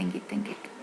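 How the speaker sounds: 1 whisper. Real, not acted. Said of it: "emphasis fading".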